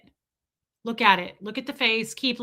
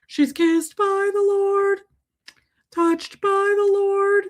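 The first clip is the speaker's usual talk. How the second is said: much higher, slow, airy reverence; mock angelic; 10 semitones higher, slower than usual. Praising the puppy as blessed, high slow mock reverence. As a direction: sing her praises like a heavenly choir.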